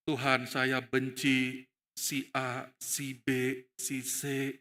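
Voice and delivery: low, slow confession; model prayer. Shown as a demonstration. Believer confessing hatred to God, slow low prayer. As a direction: confess it slowly, name by name. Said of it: "Doakan orang yang kita benci".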